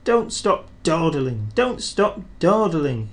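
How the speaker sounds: slow grumbling chant in a little engine voice. A narrator's reading. Thomas grumbling at coaches, slow and rhythmic. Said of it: "he grumbled".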